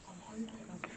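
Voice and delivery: hushed whisper in a tunnel. Real, not acted. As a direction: whisper so the tunnel won't hear.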